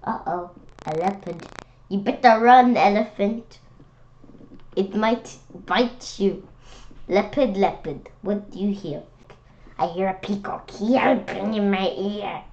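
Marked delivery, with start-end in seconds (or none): none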